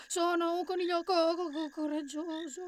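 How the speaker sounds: high, shrinking, stuttering rabbit; fearful stammer. A narrator's reading. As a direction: let the courage falter on "coraggioso". Courage fails in the repetition.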